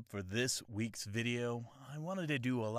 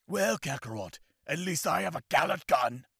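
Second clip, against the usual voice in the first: loud, higher, proud rival voice; arrogant anime rival; 5 semitones higher, 8 dB louder than usual. Retold or imitated. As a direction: sneer it like a cocky prince. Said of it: "anime impression video".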